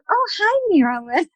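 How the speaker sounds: high-pitched "oh, hi" that drops on the name; mock awkward greeting. Greeting a puzzled acquaintance at her door, awkwardly high. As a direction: imitate an uneasy, over-bright hello.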